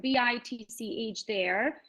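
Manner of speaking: normal talking voice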